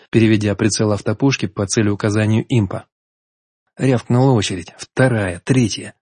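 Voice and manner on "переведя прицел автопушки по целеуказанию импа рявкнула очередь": ordinary delivery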